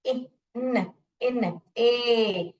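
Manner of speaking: इन split off, then a long drawn-out ए; stretched "ay" on ए after इन; sounding out syllables. Shown as a demonstration. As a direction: slowly separate इन from a long ए. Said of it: "long sound बोल रहे हैं".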